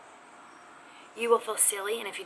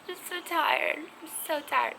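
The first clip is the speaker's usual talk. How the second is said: exhausted whine; 8 semitones higher than usual. Acted. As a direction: moan it out, utterly spent, pitched up.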